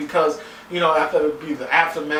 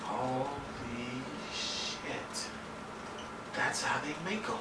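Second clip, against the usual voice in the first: stunned hush; 15 dB quieter, slower than usual. Retold or imitated. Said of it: "And I just thought".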